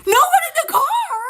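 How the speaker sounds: can't believe what she heard; disbelief. Retold or imitated. Echoing mom's claim in stunned disbelief.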